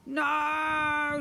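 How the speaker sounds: long, high protesting yell; shouting. A narrator's reading. Pair awash with slime, high loud shout. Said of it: "shouted the pair".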